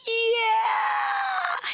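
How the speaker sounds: put-on long, high-pitched child's wail; breaking down. Three-year-old son breaking down to his mother, imitated high wail. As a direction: draw it out as a mock tearful cry. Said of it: "He just broke".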